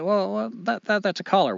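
high, stammering excuse; flustered. Acted. Dog nervously explains collar to Wolf.

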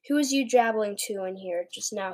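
usual speaking voice

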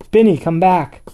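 calling out. A narrator's reading. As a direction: call after someone leaving. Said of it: "the angel called".

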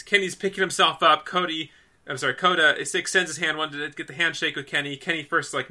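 ordinary talk, nothing marked

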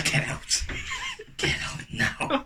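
slow breathy whisper; whispered "get out". Pretend. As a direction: whisper it slowly, menacing. Mock eviction whisper.

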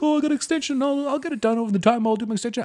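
mock carefree self. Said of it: "the classic thing where I'm like".

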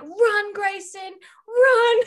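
high, loud urgent shout; yelling. Retold or imitated. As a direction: shout a warning at him. Yelling at character in book.

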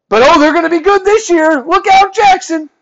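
high, loud mock excitement; over-the-top mocking cheer; sarcastic hype. Mocking the hype around North Carolina.

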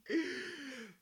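high laugh. Real, not acted. Laughing at teasing.

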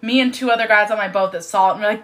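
usual speaking voice

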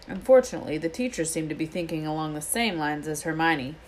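ordinary delivery

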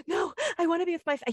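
put-on high, fast, tearful child's protest, cut off; earnestly upset child. Boy refusing his babysitter's call to go, high upset protest. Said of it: "he was so earnestly upset about it".

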